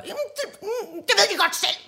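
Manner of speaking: put-on very high, whimpering child voice; teacher voicing a sobbing girl blaming the boys; little girl. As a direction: complain like a tearful small girl. Girl quoted.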